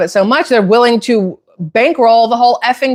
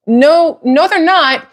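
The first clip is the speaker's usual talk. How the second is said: indignant no; 7 semitones higher, slower than usual. Real, not acted.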